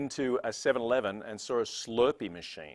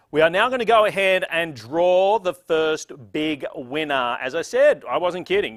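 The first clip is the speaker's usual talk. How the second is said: louder, higher, slow build-up; hyped announcement; 5 semitones higher, 9 dB louder than usual. Real, not acted. Emcee launching the prize draw, louder and hyped. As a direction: sell the big moment.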